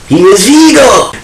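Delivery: high, loud burst of delight at the name Viggo; excited outburst. Real, not acted. Fan exclaiming over the suggested actor, excited shout.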